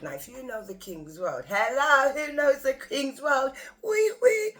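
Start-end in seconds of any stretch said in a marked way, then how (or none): 1.5-4.5 high, loud, slow call to the crowd; calling out to audience. Real, not acted. Speaker calling out to her audience, excited whoop. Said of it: "calling out to audience".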